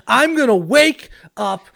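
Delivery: laughing through trash talk; wrestling promo voice. Acted. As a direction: threaten like a wrestler, half-laughing. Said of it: "Turn this into a wrestling promo".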